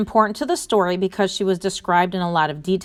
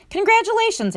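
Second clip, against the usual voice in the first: delighted congratulations; 12 semitones higher than usual. A narrator's reading. Friend congratulates astronaut in story.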